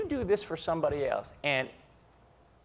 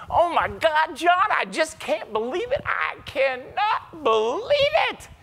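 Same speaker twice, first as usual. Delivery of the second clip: higher, slow, awestruck gasp; overwhelmed by his first private jet; wide-eyed disbelief, drawn out; amazed; 13 semitones higher, 6 dB louder, slower than usual. Retold or imitated. Amazed first jet ride quoted.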